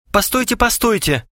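high-pitched urgent interjection; exclaimed. A narrator's reading. Gromov stops his friends mid-plan, exclaiming. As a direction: cry out to halt everyone. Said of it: "вскричал Громов".

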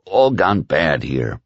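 slow, a mild misery; understated misery; miserably. A narrator's reading. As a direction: let it sag with defeat. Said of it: "Whitney said miserably".

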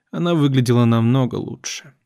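usual speaking voice

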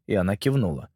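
ordinary delivery

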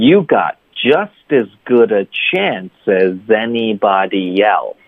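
slow, even model reading, every word joined; linked throughout. Shown as a demonstration. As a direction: chain "just as good a chance as anybody else" smoothly. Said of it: "所以从头到尾就是".

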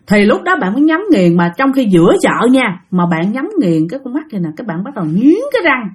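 ordinary delivery

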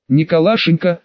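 usual speaking voice

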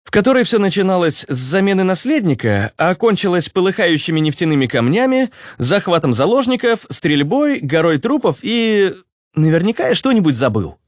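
high-pitched character voice; Руслан. Acted. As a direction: answer for someone else in a high voice. (высокий голос персонажа)

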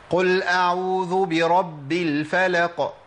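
slow chanted recitation of the verse; Quran recitation. Shown as a demonstration. Teacher reciting a verse to students, slow tajweed chant. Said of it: "شفتوا هناك أنا قلت إيه".

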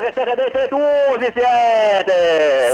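Sicilian accent. Shown as a demonstration. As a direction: say it like a Sicilian insult. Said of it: "voglio sentirgli dire qualcosa in siciliano".

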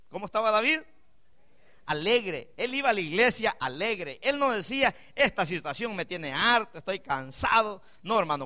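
rising fervent sermon cry; full-force preaching. Acted. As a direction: preach it out to the whole church. Preaching rises again.

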